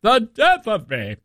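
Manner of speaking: a touch of Victorian drama on "death"; mock theatrical lament. Shown as a demonstration. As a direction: ham up the line like a stage invalid. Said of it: "I say".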